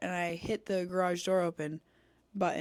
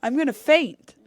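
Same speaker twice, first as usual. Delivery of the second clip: much higher and louder panicked repeat of I'm gonna faint; overwhelmed shout of I'm gonna faint; panicked cry; 7 semitones higher, 11 dB louder than usual. Retold or imitated. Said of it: "I look at him and I go".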